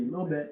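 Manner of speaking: লোভে with the final b echo pulled downward; downward qalqalah bounce. Shown as a demonstration. Teacher demonstrating the letter ba, downward qalqalah bounce. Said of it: "নিম্নমুখী".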